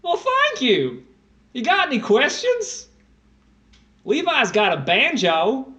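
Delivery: loud, high, drawn-out Texan twang; exaggerated Texas accent, swooping high; put-on Southern accent. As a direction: holler it in a hammed-up Southern drawl. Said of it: "I find that accent very racist".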